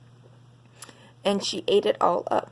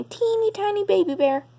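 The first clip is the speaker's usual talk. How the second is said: put-on much higher and fast, tiny baby voice; 10 semitones higher than usual. A narrator's reading. Reading to children, the baby bear in a high quick voice.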